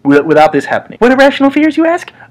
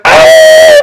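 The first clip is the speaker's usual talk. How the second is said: very high, loud shriek; jump-scare scream like a ghost; ghost girl shriek; 9 dB louder than usual. Retold or imitated. Imitating an evil ghost girl's jump-scare shriek. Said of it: "she's like".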